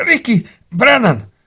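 slow, high, loud groaned reply; pained answer. A narrator's reading. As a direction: force the name out through agony. Wounded suspect answering in pain. (fájdalomtól elcsukló, magas hang)

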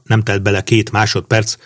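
plain reading voice

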